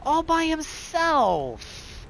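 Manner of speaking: high, slow pitying whine; mock pity. Player pitying the lonely character, high mock whine. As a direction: pity him in a drawn-out high voice.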